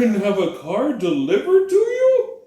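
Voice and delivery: naive buddy voice, pitched well up; mock incredulous question, high and drawn up; African buddy. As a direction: put on a clueless newcomer. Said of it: "his African's buddy is like".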